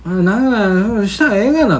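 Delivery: imitating his mother. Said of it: "とか言うおかん".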